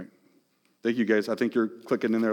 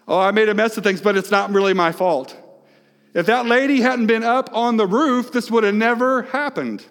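mock excuse; 8 semitones higher, 4 dB louder than usual. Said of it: "here's what we would say today".

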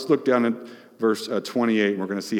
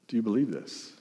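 softer, searching question; quiet repeat; 7 dB quieter, faster than usual. Shown as a demonstration. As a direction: drop it down and ask gently. Repeated quietly after first take.

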